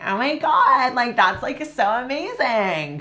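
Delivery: put-on high, bright valley girl voice; valley girl accent. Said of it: "make fun of the way that the valley girls talk".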